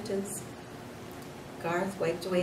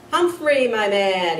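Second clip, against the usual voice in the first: loud, high joyful yell; a booming happy hello; yelled greeting; 3 semitones higher, 12 dB louder, slower than usual. A narrator's reading. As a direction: call out his name with delight. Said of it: "he yelled".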